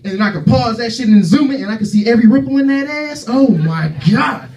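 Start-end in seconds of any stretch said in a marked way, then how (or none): none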